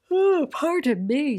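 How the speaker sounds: talking through a real yawn. Real, not acted. Vlogger excusing herself to viewers mid-yawn. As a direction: let a yawn stretch the words out slowly. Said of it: "Sorry, I'm yawning".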